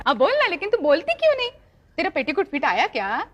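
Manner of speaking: ordinary talk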